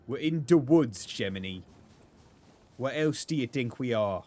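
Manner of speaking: loud comic voice explaining the obvious; goofy, hammed-up exasperation; silly put-on voice. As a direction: punch it out in a funny character voice. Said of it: "talking like this".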